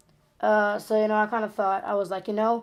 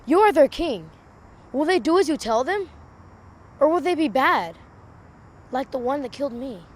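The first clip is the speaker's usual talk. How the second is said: monologue line; 5 semitones higher, 3 dB louder than usual. Acted. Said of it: "'cause I say".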